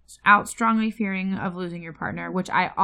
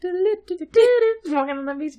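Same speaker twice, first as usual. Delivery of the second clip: high, slow silly cartoon voice; 10 semitones higher, 5 dB louder, much slower than usual. Joining the SpongeBob bit with her partner, high and slow. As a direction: stretch it out in a squeaky cartoon voice.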